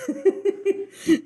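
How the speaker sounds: bursts into high laughter. Real, not acted. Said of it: "You can do the after accent".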